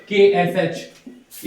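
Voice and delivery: के एस एच spelt out very slowly; letter-by-letter slow spelling. Shown as a demonstration. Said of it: "जैसे क्ष-, क्षत्रिय हो".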